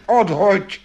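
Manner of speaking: high-pitched urgent order; calling out. A narrator's reading. Magic horse ordering Janko to drop it, calling out. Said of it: "zvolal tátoš".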